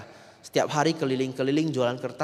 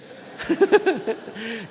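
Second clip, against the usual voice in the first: high laugh; 9 semitones higher than usual. Real, not acted. Preacher laughs at the story.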